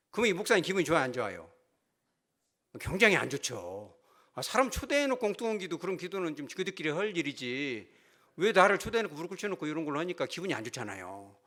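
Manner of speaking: ordinary talk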